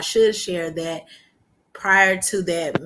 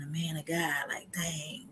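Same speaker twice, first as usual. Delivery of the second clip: a hushed worried whisper; whispered realization; 11 dB quieter, slower than usual. Retold or imitated. Woman realizing the coach is a man of God, whispering. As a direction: murmur the dawning worry.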